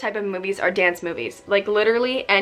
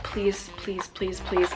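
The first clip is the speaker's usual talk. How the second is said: much softer, pleading repeat; begging; 8 dB quieter, much slower than usual. Retold or imitated. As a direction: beg quietly, over and over. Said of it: "I'm literally, like, begging".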